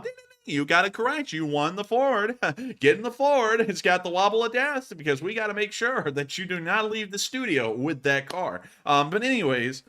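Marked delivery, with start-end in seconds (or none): 0.0-8.6 high, playful prize announcement; hammed-up quiz host awarding a car; mock game-show host. Host tells contestant they won the Ford, mock game-show excitement.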